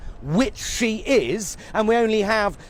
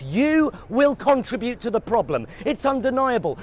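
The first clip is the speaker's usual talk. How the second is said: ordinary talk, nothing marked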